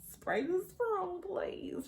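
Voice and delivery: high, slow retold begging; hushed plea. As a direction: beg urgently under your breath. Pleading before her man wakes.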